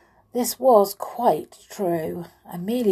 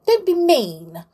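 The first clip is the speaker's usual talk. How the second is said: indignant protest; 9 semitones higher, 5 dB louder than usual. A narrator's reading. Amelia protests at being refused.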